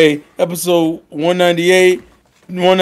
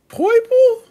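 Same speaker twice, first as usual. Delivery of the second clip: much higher, disbelieving squeak; high squeaky Poipo. Retold or imitated. Ash squeaks the Pokemon's name in disbelief. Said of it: "Tyrone's like".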